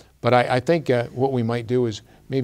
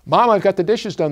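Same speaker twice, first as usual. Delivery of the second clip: high, loud call to mom; eager girl calling to her mother; calling from the door; 8 semitones higher, 6 dB louder than usual. A narrator's reading. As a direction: call out proudly from the doorway. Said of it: "the girl called from the door".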